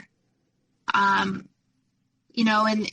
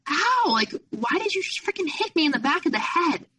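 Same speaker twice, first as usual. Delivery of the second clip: high, outraged yelp of protest; retold indignant cry, much higher; 8 semitones higher than usual. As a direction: squeal it back, stung and offended. Retold protest after being slapped.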